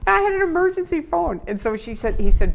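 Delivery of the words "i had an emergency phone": much higher, incredulous emphasis on "phone"; emphatic repeat. Shown as a demonstration. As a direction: repeat it high and amazed, leaning on "I". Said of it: "it's gonna sound funny to people now".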